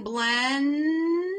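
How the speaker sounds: put-on slow drawn-out "blending" as a child sounds it. Shown as a demonstration. Teacher modelling a child blending a long word slowly. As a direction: stretch "blending" out syllable by syllable. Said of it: "the child says blend".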